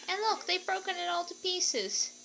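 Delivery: high, lightly voiced dismay; upset Baby Bear. A narrator's reading. Baby Bear finding his broken chair, a touch upset. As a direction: tell it like a cub near tears.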